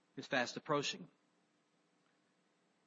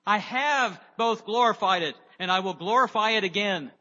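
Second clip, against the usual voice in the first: louder, booming heavenly pronouncement; 3 semitones higher, 8 dB louder than usual. Acted. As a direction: proclaim it from above, loud and grand. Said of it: "a voice came from heaven saying".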